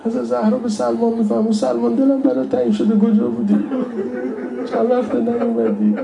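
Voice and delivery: high, pleading mourner's voice; tearful lament preaching. Real, not acted. Preacher longs for the Imam to miss him, tearful pleading. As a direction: plead high, close to weeping.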